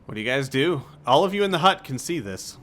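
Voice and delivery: ordinary delivery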